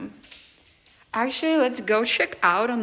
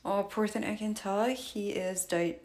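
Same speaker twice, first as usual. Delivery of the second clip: ordinary delivery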